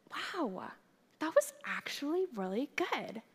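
mock-sweet sarcastic praise; sarcastic backhanded praise. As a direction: hand her a backhanded compliment. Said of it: "That's so sarcastic and awful".

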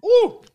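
high, loud whoop at the heat. Real, not acted. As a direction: let out a big woo. Reacting to the hot wing.

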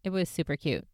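ordinary delivery

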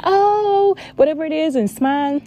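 lightly voiced cheery self, high oh; breezy happy take, lifted pitch; mock cheerful. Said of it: "you can be like".

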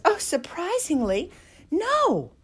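high, light voice for Audrey; imitating Audrey. Audrey replying about the puppy, high and light. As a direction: lift the voice for the rescuer. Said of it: "she says".

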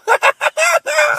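high, loud shriek of laughter. Real, not acted. Host shrieks with laughter at the story's twist. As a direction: burst into a piercing laugh. Reacting to daughter downing wine.

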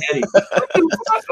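high-pitched mimicry of a small boy; little kid voice. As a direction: imitate your son calling to his dad, high and childish. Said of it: "with the little ass kid voice".

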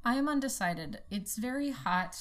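ordinary talk

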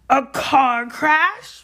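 high, loud, very drawn-out roar; explosive, indignant question; outraged roar. A narrator's reading. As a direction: roar it in disbelief. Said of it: "roared Hagrid".

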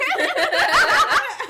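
loud, high laughing fit; big burst of laughter. Real, not acted. Friends cracking up at a frank answer, loud laugh.

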